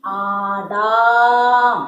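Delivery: ആടാം very slow and loud, last syllable held; drawn-out long vowel. Shown as a demonstration. Teacher repeating the spelled word for pupils, ആടാം drawn out. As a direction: stretch the daam in ആടാം. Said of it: "ദീർഘം".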